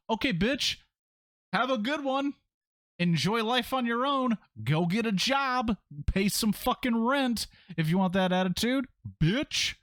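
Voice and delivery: very high, mocking voice; mock sassy send-off. Dad telling teen to pay rent, high-pitched sarcasm. Mock retort to daughter's curfew complaint.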